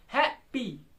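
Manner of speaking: Happy with strong है and पी falling down; happy split, weight on the first syllable; strong-weak stress. Shown as a demonstration. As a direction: lean on है, drop पी in happy. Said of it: "नीचे आ रहा है पी".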